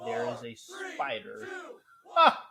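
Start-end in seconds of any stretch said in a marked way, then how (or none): 2.2-2.5 sudden high loud yelp of fright; short shrill-free high cry of alarm; startled high yelp. Real, not acted. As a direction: jump at the spider with a yelp. Spider right next to him.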